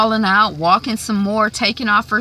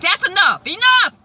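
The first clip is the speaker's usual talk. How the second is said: sharp higher, louder shout; mock shout; 11 semitones higher, 5 dB louder than usual. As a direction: bark it out, much higher. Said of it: "He's like".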